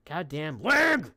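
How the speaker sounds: sudden high, loud yell of annoyance; frustrated yell. Real, not acted. Streamer yelling at his lagging stream, annoyed. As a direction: yell at the lag in real frustration. Stream lag interrupts him.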